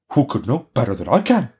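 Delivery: lightly voiced proud reindeer; a hint of an animal character. A narrator's reading. Reindeer answers the robber girl, lightly voiced. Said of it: "the animal said".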